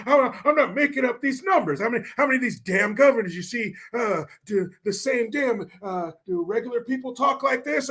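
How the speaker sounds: high, halting parody of a politician; put-on high voice, fumbling for the words; Biden. Host mixes up two politicians while mimicking, high halting voice. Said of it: "I was talking like Biden".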